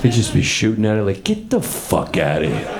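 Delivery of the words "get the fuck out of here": low, slow Italian tough-guy voice; Brooklyn Italian guy. Acted. Italian shooting at invading aliens, low slow tough-guy dismissal. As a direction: drop it low and draw it out. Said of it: "aliens invaded an Italian neighborhood".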